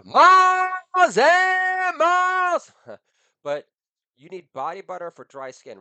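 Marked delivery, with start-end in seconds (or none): 0.0-2.7 very high, slow and loud drawn-out line; high, slow mock croon; stretched high singsong. Comic singsong about daughter's legs.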